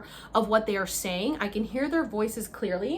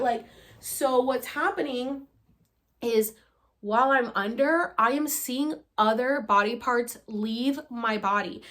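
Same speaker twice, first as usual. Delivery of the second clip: ordinary talk, nothing marked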